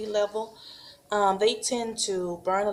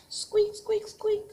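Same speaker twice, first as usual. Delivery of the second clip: very high, tiny squeaky voice; hamster squeaks; 13 semitones higher, slower than usual. Shown as a demonstration. Teacher showing the hamster sound, high tiny squeaks. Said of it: "What sound does a hamster make?".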